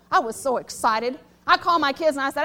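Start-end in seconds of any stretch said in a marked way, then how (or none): none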